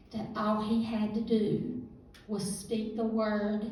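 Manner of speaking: ordinary talk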